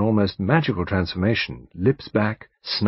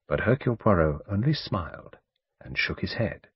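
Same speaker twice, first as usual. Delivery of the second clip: plain reading voice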